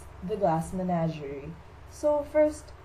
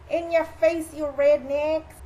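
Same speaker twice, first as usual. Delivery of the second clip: loud, high gloating jeer; triumphant taunt; 7 semitones higher, 7 dB louder, slower than usual. Acted. Playwright gets back at his mocking classmates, gloating. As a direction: crow it at them, drawing out the last words.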